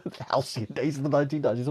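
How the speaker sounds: laughing. Real, not acted. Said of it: "I don't know what even I'm talking about now".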